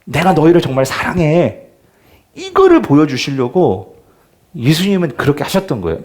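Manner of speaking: ordinary talk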